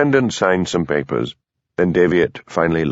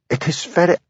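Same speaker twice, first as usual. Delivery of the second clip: higher, nervous Highland Scottish lilt; nervous Highland accent; 9 semitones higher than usual. A narrator's reading. Hamish defending himself, strong Highland accent. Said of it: "his Highland accent strengthened by his nervousness".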